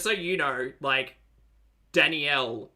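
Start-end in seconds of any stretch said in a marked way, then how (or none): 1.9-2.7 Danielle in three beats, dan-ee-ELL; three syllables. Shown as a demonstration. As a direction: sound the "ee" in Danielle. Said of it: "is a three-syllable name that exists".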